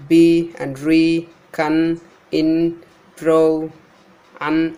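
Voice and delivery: ordinary delivery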